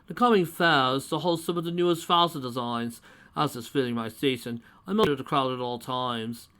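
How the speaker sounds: lightly voiced self-important connoisseur; fussy posh old man; pompous gentleman. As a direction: talk down to them grandly.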